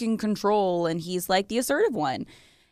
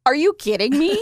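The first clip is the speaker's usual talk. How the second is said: incredulous; 8 semitones higher, 8 dB louder than usual. Real, not acted. Incredulous reaction to Fifty Shades.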